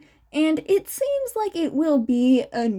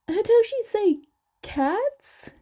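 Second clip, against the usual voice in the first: startled realization; 9 semitones higher than usual. Acted. Father's realization.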